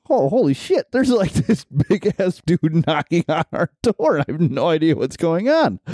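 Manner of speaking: retold shock. Peeking out at a big guy knocking, startled disbelief. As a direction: gasp it out like you just spotted a stranger. Seeing big stranger at door.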